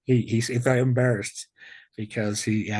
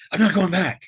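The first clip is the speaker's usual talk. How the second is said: retold outburst, much higher and louder; blowing up; 9 semitones higher, 6 dB louder than usual. Refusing to leave the Lord, retold angry outburst, high and loud. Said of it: "I kind of blew up a little bit".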